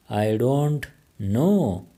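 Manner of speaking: each word drawn out slowly for learners; very slow, careful. Shown as a demonstration. English teacher modelling the phrase for Pashto learners, very slow. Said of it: "teacher repeats the phrase twice".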